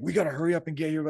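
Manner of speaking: high, loud, rushed panicky yell; frantic yelling. Pretend. A stressed leader yelling orders at his troops, frantic fast shout. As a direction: bark it like a leader losing his mind. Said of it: "I lost my mind and I start yelling".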